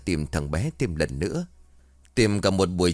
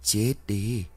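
ghostly curse. A narrator's reading. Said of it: "Một dòng cười ghê sợ vang lên".

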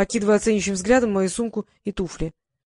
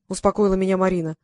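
ordinary delivery

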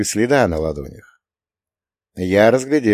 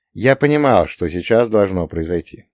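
plain reading voice

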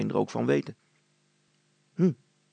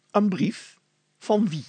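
surprised question; 7 semitones higher, slower than usual. Acted. Surprised reply about a letter.

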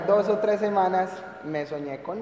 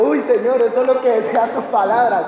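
loud, raised re-enacted exclamation; retold excitement; 5 semitones higher, 10 dB louder than usual. Dreamer retelling the rapture to listeners, loud excited exclamation. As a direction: exclaim it as if reliving the wonder. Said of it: "correr de la felicidad".

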